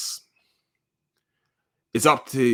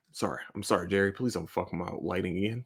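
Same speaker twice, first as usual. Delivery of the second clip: ordinary talk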